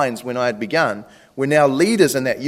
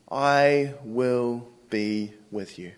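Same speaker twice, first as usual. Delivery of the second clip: slow, softer, word by word; solemn promise; 2 semitones lower, 6 dB quieter, much slower than usual. Acted. God reassuring reluctant Moses, slow and solemn. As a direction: give each word its own space, gently. God's promise voiced, slowly.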